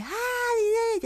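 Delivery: very high, loud squeal of a name; imitating the woman. Stranger at a window calling out to her. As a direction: shriek the name like a startled acquaintance. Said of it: "elle me fait".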